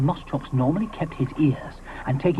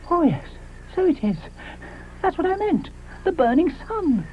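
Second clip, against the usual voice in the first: high, bluffing dinosaur voice; embarrassed Moschops; 14 semitones higher than usual. Acted. Moschops bluffs to his friends, high embarrassed voice. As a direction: cover the slip with a high quick bluff.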